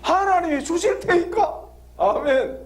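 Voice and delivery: normal talking voice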